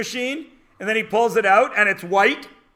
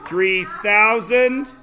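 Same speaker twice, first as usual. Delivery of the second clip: slow dictation; slower than usual. Shown as a demonstration. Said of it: "teacher dictates answer slowly".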